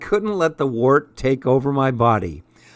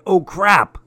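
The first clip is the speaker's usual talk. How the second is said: higher, louder second "Oh, crap"; rising alarm at the blood; panicked groan; dismayed; 7 semitones higher, 4 dB louder than usual. A narrator's reading. Repeated dismay over bleeding.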